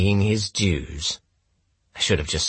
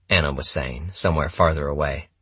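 usual speaking voice

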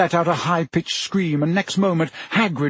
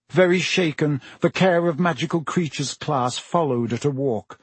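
ordinary delivery, nothing marked

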